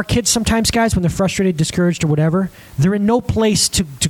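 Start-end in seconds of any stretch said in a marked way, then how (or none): none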